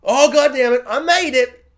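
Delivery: hammed-up frustrated chef; mock angry triumph. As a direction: curse as if success is a burden. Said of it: "him being angry could be like".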